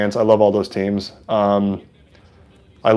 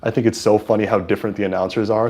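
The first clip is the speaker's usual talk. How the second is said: ordinary talk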